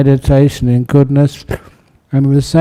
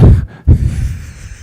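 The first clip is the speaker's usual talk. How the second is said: short breathy chuckle; a brief, voiceless laugh. Real, not acted. Chuckles during volunteer appeal.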